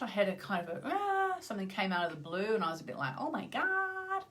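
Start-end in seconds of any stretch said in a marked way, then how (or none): 0.8-1.4 high, drawn-out mock "aah"; mock distressed high moan; upset groan. Describing yesterday's work upset, mock distressed cry. 3.2-4.2 high, slow, drawn-out dismay; mock dismay. Retelling a work upset out of the blue, mock dismay.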